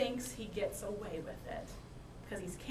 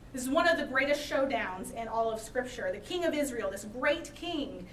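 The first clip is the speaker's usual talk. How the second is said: ordinary talk, nothing marked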